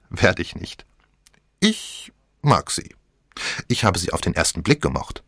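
hint of a saucy teenage girl; cheeky young woman. A narrator's reading. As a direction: tease him for an imaginary audience. Young woman voiced in story.

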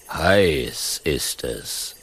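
slow, low lazy rumble; slow low lion grumble; lion's grumble. A narrator's reading. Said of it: "brummt der Löwe".

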